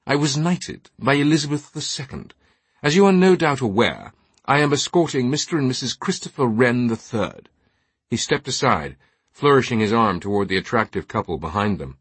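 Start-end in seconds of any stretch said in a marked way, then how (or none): 0.1-7.5 higher-pitched, pompous British knight; highbrow British accent. A narrator's reading. Knight presenting his guests to an altar boy, highbrow British accent. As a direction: flaunt the title in upper-crust British. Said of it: "his accent a highbrow Saxonesque British".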